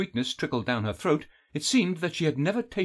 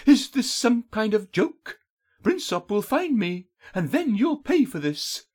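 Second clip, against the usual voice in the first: high, measured threat; captive's high-pitched defiance; indignant heroine; 10 semitones higher, 3 dB louder than usual. A narrator's reading. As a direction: warn the captor in a high, tense voice. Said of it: "she kept her voice low and calm".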